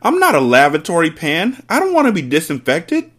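high, indignant protest; crying out. A narrator's reading. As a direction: protest in alarm as the husband. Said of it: "the husband cried".

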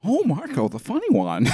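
camp it up like the Joker; hammed-up Joker version of the Drode's taunt; Joker-style Drode. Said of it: "Let's redo the Drone as the Joker".

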